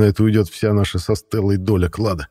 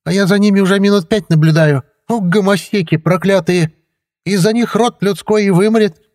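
much higher, loud scandalised woman's voice; indignant old woman; 14 semitones higher, 6 dB louder than usual. A narrator's reading. Woman neighbour scolding the pair on the bench, loud and high. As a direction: rail at them like an outraged neighbour. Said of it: "другой голос, женский".

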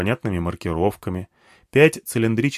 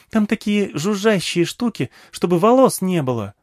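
put-on high, eager child voice; small girl eagerly addressing an old man; little girl; 10 semitones higher than usual. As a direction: pitch it up like a chatty little girl. Girl describes odd gadgets.

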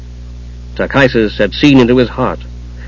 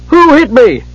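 high-pitched indignant kender; indignant demand; 12 semitones higher than usual. A narrator's reading. Tass demanding to know who hit him, indignant. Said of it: "Tass demanded".

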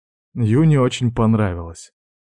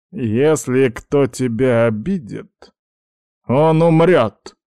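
slow threat building to a loud, high finish; old grandfather threatens, rising to a shout; 4 semitones higher, 3 dB louder, slower than usual. A narrator's reading. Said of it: "прокричал в динамик старик".

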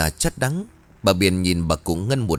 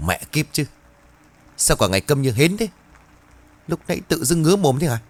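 higher, fast, venomous scolding of her husband; spiteful cursing; 7 semitones higher, faster than usual. Acted. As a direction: spit the curses at him. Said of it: "hằn học nhìn chồng rồi rủa". (giọng rủa rít hằn học)

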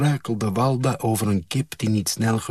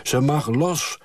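mild narrated shout, higher pitch; shouting back; 6 semitones higher than usual. A narrator's reading. Father shouts back to the farmer, hurried. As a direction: throw it over your shoulder. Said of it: "schreeuwde mijn vader over zijn schouder".

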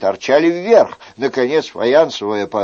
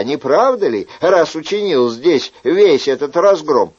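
reasoning Chicot; 6 semitones higher than usual. Acted. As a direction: lecture the host step by step. Chicot reasoning, voiced higher.

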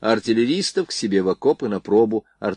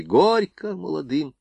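higher, drawn-out toast call; mock guests' cheer; 6 semitones higher, slower than usual. A narrator's reading. Wedding guests toast the newlyweds, mild drawn-out cheer. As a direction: call it out slowly, without real shouting. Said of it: "под крики гостей".

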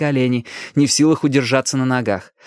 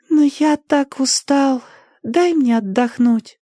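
put-on high, slow, drowsy child; weary boy; 11 semitones higher, slower than usual. A narrator's reading. Danny begging Ali to let him rest, wearily. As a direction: whine sleepily like a tired boy.

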